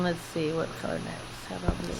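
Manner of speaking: whispered mutter. Real, not acted. Muttering to herself.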